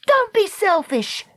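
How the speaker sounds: high, scolding cry; indignant cry. A narrator's reading. Dakota snatching the turtle from Treacle, indignant cry. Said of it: "Dakota cried".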